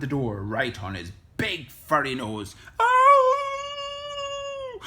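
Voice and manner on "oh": long, high, loud howl; wolf howl. A narrator's reading. Wolf hit on the nose by the door, long howl. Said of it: "howled the wolf".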